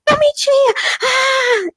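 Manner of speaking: piercing high shriek ending in a wordless wail; screaming. Retold or imitated. Woman in hell confessing that she lied, screaming. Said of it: "E ela gritava".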